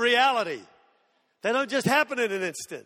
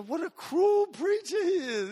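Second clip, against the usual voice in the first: mock indignant crowd; 8 semitones higher, slower than usual. People mocking the preacher.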